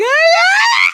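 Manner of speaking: high, loud reenacted teenage shriek; mock tantrum scream. Teenage girl answering mother's door-slamming rule, screaming. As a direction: shriek like a teen throwing a fit. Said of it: "I'm running my room screaming".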